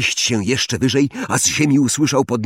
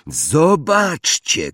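mild, drawn-out higher call; Malfoy shouting; 6 semitones higher, much slower than usual. A narrator's reading. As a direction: call the others over, stretching the word. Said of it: "krzyknął Malfoy".

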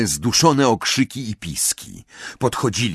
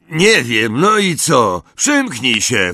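loud, raised, rude bark; coarse snarl; 5 semitones higher, 6 dB louder, slower than usual. A narrator's reading. Thug answers the eagle knocker's riddle, coarse snarl. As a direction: snap back coarsely. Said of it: "warknął ordynarny głos".

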